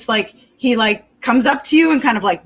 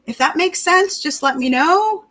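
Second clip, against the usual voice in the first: ordinary delivery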